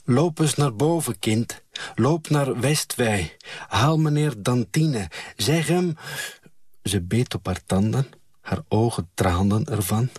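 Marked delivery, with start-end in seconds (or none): 0.0-6.0 higher-pitched urgent plea; urgent order. A narrator's reading. Mother in labour sends a child for help, urgent.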